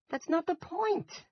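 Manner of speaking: high, slow, loud exasperation; nearly groaning. A narrator's reading. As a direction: drag it out in a pained near-groan. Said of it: "Jack nearly groaned".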